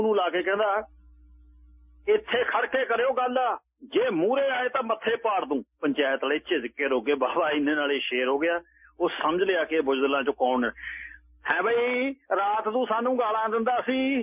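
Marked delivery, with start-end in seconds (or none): 2.1-5.6 put-on drunken threat. A narrator's reading. Drunk baba threatens panchayat from roof. 11.5-14.2 high, indignant demand; a farmer confronting the baba who cursed them; angry question. A narrator's reading. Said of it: "ਗੁੱਸੇ ਨਾਲ ਪੁੱਛਿਆ".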